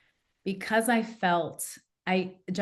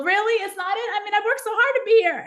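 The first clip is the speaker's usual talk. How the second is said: much higher, louder and fast, pleading; whiny high protest; 7 dB louder, faster than usual. Retold or imitated. Arguing with God about her job, high pleading whine. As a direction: squeak out a disbelieving protest. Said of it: "And I go".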